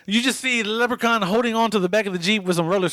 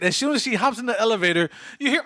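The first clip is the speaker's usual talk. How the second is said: ordinary delivery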